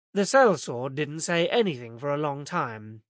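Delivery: ordinary delivery, nothing marked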